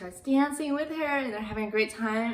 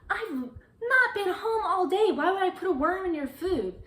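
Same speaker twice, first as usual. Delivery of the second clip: indignant denial; 9 semitones higher, 4 dB louder than usual. Retold or imitated. Lisa protesting to stepmother Janet, high indignant whine. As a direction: pitch it up in disbelief.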